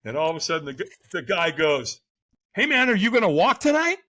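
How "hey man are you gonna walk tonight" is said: loud, high-pitched mock enthusiasm; eager stranger. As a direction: call out like an over-friendly stranger. Retold stranger calls out.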